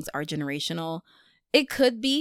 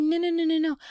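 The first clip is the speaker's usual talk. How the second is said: bossy refusal to God; mock protest; playful protest; 9 semitones higher, faster than usual. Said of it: "I wanna be like".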